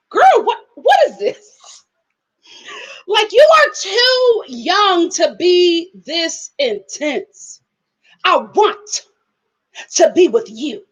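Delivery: high, slow, incredulous imitation; mock exasperated Malcolm. Vlogger plays Malcolm answering Sarah, high disbelieving whine. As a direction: ham up a boyfriend's disbelief.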